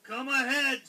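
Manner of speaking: bold, loud billy goat voice; challenging big goat. A narrator's reading. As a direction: dare the troll as the big goat. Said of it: "said the big Billy Goat Gruff".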